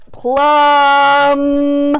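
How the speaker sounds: slow, held plum; stretched plum. Shown as a demonstration. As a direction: sing out plum, leaning on the short u. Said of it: "Uh is the short U".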